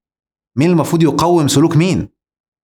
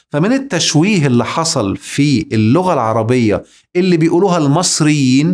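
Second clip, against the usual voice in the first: normal talking voice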